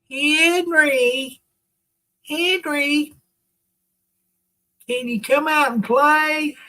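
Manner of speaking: high sing-song call to come play; kid calling a friend. Pretend.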